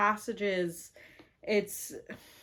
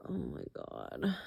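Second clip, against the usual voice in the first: low, slow, softer, overcome; getting emotional; 5 dB quieter than usual. Real, not acted. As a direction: let it out low, a little choked up. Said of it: "It's getting a little emotional".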